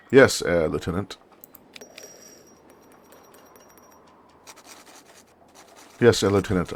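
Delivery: loud, crisp acknowledgement; dutiful junior officer. Acted. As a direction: lightly play a soldier answering his superior.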